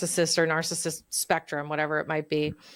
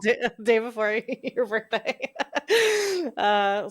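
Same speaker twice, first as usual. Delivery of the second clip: talking through laughter; real laughing; 3 semitones higher, slower than usual. Real, not acted. Guest laughing with host about the birthday, amused. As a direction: let the laugh break into the words.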